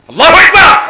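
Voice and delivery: high, very loud rallying cry; shouted takbir. Real, not acted. Preacher leading the congregation in takbir, high rallying shout. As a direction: belt it out for the crowd.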